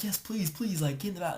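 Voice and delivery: high-pitched retold begging; mock pleading. Friend begging the girl to hide, in mock pleading. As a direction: imitate someone urgently pleading, without raising the volume.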